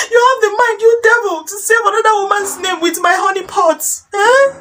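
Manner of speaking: high, tearful-sounding melodramatic wail; betrayed wife's tirade. Acted. Wife reproaching her cheating husband, high wailing outrage.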